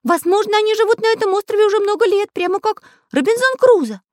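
very high, loud storybook character voice; high-pitched, emphatic little figure; voiced cartoon Pencil character, earnest. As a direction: pipe it up high and loud, speculating.

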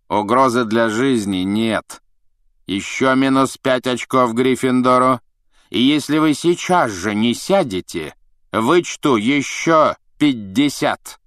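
slow, cold threatening professor; stern teacher. A narrator's reading. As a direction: drag out the threat. Snape threatens Harry.

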